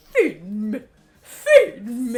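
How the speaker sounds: hungry plant growling to be fed; laughing monster-plant demand; plant monster demanding food. Acted. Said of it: "the plant is like".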